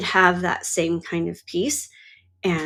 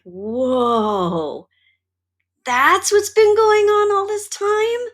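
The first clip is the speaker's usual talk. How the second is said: soaring high, louder disbelief; stunned realization; 11 semitones higher, 4 dB louder, slower than usual. Retold or imitated. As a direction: act out the sudden shock of seeing it. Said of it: "And it was like".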